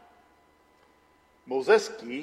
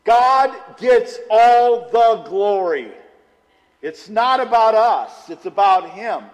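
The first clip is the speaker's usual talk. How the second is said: preaching at full force; 4 semitones higher, 8 dB louder than usual. Acted. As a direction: hammer home each word from the pulpit. Preaches the main point.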